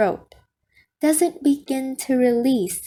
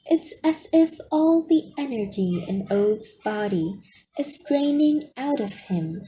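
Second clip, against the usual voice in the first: ordinary delivery, nothing marked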